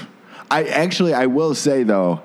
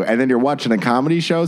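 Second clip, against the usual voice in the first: ordinary delivery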